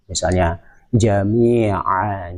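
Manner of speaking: jami'a drawn out slowly with a clear j; slow correct jami'a. Shown as a demonstration. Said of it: "jami'a tidak boleh dibaca".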